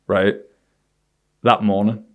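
ordinary talk, nothing marked

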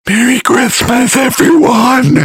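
high, slow, airy inhaled words; speaking on inhale. Shown as a demonstration. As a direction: say it while breathing in, creepily. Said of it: "they can speak while inhaling".

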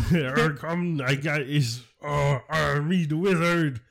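swaggering wizard. Pretend. Host plays the swaggering wizard peddling mushrooms, hammed up. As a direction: strut in with it like a cocky wizard.

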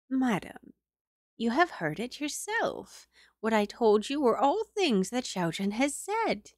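high, confiding servant woman; old servant voice. Acted. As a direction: tell the madam confidentially. Old maid speaks to madam.

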